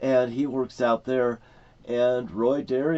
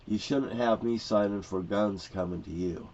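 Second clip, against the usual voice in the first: normal talking voice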